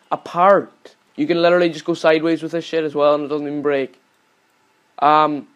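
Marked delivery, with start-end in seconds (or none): none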